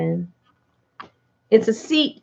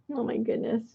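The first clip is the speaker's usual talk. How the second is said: yawning; 3 semitones higher, 11 dB quieter, faster than usual. Real, not acted. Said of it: "I'm actually yawning".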